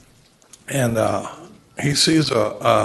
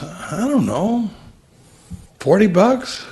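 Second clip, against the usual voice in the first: unsure farmer; 8 semitones higher, slower than usual. Retold or imitated. Hesitant farmer in the joke.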